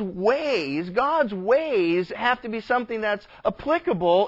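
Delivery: preaching. Real, not acted. Preacher telling the church about the law and pride, preaching. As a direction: drive the point home to the congregation.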